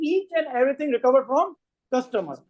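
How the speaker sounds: each and everything said word by word, very slowly; slow emphatic rule. Shown as a demonstration. Teacher drilling students on cost recovery, very slowly. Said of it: "teacher spells out key rule".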